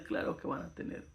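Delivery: a soft whispered reassurance. Real, not acted. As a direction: breathe it out like a secret comfort. Preacher reassures softly.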